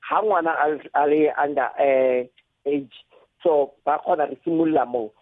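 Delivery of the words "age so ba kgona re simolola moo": ordinary delivery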